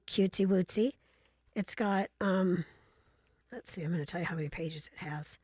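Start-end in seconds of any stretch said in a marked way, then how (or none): 3.5-5.4 quick whispered aside. Real, not acted. Aside while checking the book.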